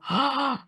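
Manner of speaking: mock amazed gasp; awed gasp. Voice actor imitating parents watching her record, mock awed gasp. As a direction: gasp like an awestruck fan. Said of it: "they go".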